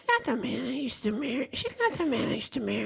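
high, soft, breathy imitation of a frail mother; frail old mother. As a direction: copy her weak, faltering voice. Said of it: "This is what she kinda sounds like".

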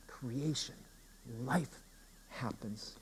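very soft, one word at a time; hushed preaching. Acted. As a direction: drop almost to a whisper, pausing between words. Preacher drops to a hush.

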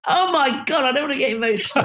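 host tearing up over a film he loves, voice rising; getting emotional. Real, not acted. Talking about a film that moves him, near tears. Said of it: "I'm getting emotional now".

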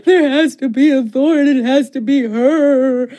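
exaggerated crying over a tragic line; mock weeping. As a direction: blubber it out, overdoing the sobs. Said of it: "I'm weeping".

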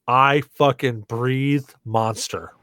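ordinary delivery

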